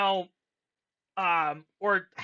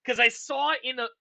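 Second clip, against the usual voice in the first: normal talking voice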